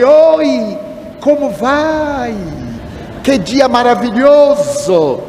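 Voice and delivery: high, drawn-out, hammed-up friendliness; overly effusive greeting. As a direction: gush sweetly so nobody can feel snubbed. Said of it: "ter muito mais cuidado com ela". (cumprimento exageradamente efusivo, agudo e arrastado)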